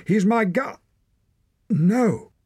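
very high, loud desperate cry; yelling. A narrator's reading. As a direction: yell in panic, cutting off mid-word. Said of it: "yelled Torak".

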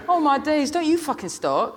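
very high, exasperated outburst; girl crying out. A narrator's reading. Girl turning on the man who joined in, exasperated cry. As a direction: burst out in a high teenage voice. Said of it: "cried the girl".

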